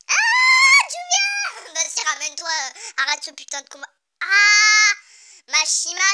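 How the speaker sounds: very loud, high fan scream; hyped shout. Real, not acted. Reader cheering Julia's arrival, hyped shout. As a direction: yell at the page like a fan.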